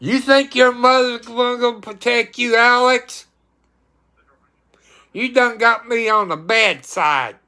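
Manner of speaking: high, stern telling-off of the cat; scolding Alex for misbehaving. Real, not acted. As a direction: warn her she's in trouble.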